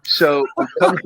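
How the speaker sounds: usual speaking voice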